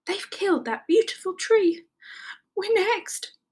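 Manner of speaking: high-pitched dismayed whimper; lament, then fear for yourself; fearful, melodramatic squeal; horrified. A narrator's reading. Rabbit horrified at felled tree.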